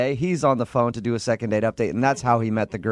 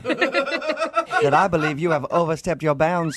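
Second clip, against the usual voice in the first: higher, hammed-up offended Southern belle accent; 4 semitones higher than usual. Host mocking Brittany as a Southern belle with a hammed-up accent. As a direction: play a scandalized Southern lady, over the top. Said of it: "really cute Southern accent".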